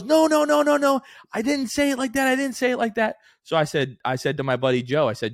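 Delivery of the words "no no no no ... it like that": much higher, louder flustered denial; imitating Heather. Heather denies calling the host bald, high and flustered. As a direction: squeal out a panicky denial. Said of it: "she goes".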